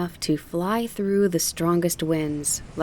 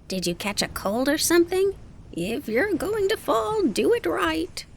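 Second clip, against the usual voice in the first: teasing townsperson; 11 semitones higher than usual. Acted. Townsperson teasing Kiki in air.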